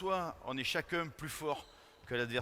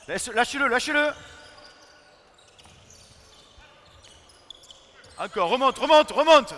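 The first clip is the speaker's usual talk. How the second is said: shouting instructions; 9 semitones higher, 14 dB louder than usual. Real, not acted. Coach yelling at players during drill.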